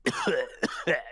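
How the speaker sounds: acted cigar smoker's cough; character's cough. Constable breaking off his praise, smoker's cough. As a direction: cough as the character, mid-speech. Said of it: "he coughs".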